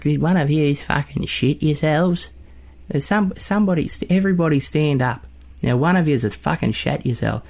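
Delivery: high-pitched imitation of an irate Nan; ranting Nan. Nan interrogating the whole family over the smell, ranting. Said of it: "She's going off at my other Nan".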